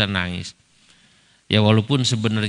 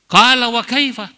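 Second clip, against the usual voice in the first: high, loud challenging question in Arabic; surprised Arabic question; 11 semitones higher, 5 dB louder than usual. Retold or imitated. Kiai Ma'ruf asks the Moroccan cleric, high and loud. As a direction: demand it in Arabic, raising the pitch. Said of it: "Kata Pak Kiai Ma'ruf".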